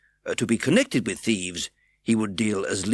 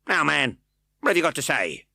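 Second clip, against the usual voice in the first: impatient; 7 semitones higher, 5 dB louder than usual. A narrator's reading. Fang impatient.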